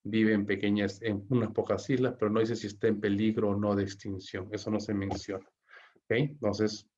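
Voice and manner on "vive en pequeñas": ordinary delivery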